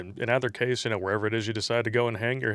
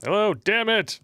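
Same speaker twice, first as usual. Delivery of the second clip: high-pitched, annoyed calling out; frustrated high hello; 9 semitones higher, 3 dB louder than usual. Real, not acted. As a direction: call out, then curse in a high voice. Call keeps dropping, frustrated.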